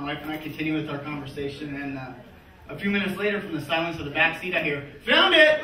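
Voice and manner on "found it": high, loud cry of discovery; triumphant kid's shout. Acted. Son announcing his discovery to parents up front, triumphant shout. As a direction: call it out proudly from the back seat. Son calling from the back seat.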